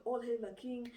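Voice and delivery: mildly acted breathless alarm; a touch of mock panic; panting, quaking messenger. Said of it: "panting and, you know, quaking".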